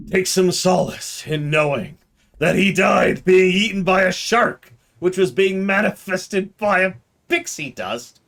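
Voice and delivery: high, slow, hammed-up letter voice; mock solemn. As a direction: ham up the ending of the letter. Mock reading, voice climbs.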